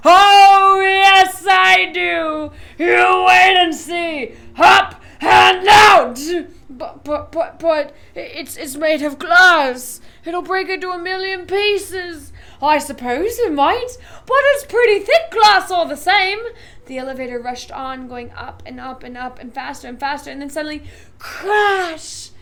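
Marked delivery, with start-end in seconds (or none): 0.1-6.2 loud, higher, slow triumphant yell; gleeful shout. A narrator's reading. 6.7-12.3 loud, stuttering fear; panicked stammer. A narrator's reading. Grandpa Joe panicking. 12.6-16.4 loud, airy unconcern; breezy loud Wonka. A narrator's reading. 21.2-22.3 sudden impact; loud crash. A narrator's reading. Crash narrated.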